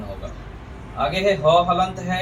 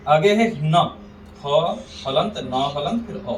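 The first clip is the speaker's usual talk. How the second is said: ordinary delivery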